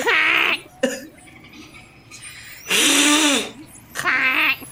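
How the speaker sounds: short, sharp imitated goose honk; goose quack. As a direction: quack at them like an indignant goose. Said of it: "and he just goes".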